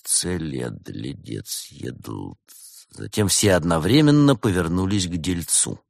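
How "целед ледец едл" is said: low, very soft muttering; whispering to oneself. Acted. Guests work out the anagram, whispering to themselves. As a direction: puzzle it out under your breath. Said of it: "зашептали каждый себе под нос".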